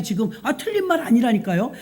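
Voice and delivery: usual speaking voice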